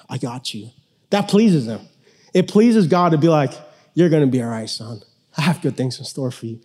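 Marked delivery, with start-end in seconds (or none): 0.1-0.7 low, soft and gentle, as God comforting; tender reassurance. Pretend. God reassuring a fearful believer. 3.9-6.6 quiet promise from a loving father; tender, low-voiced reassurance; gentle fatherly comfort. God comforting his son.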